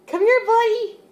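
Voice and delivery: high-pitched call to a pet; calling the dog. Real, not acted. Owner calling her stuck dog over, high-pitched coaxing.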